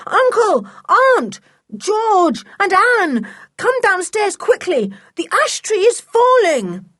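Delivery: high, loud calls through the house; panicked yelled warning; shouting at the top of his voice. A narrator's reading. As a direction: call everyone urgently, name by name. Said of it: "Shouting at the top of his voice".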